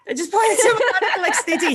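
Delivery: high, loud laughter through the words; laughing. Real, not acted. Telling a friend about flirting, laughing hard. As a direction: crack up while telling it. Laughing retelling a cheeky moment.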